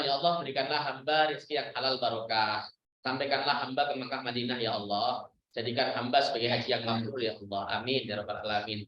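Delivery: low, gentle asking prayer; humble prayer. Retold or imitated. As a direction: pray it low and calm, sure of being heard. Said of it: "Misalnya contoh". (doa rendah hati, suara rendah dan tenang)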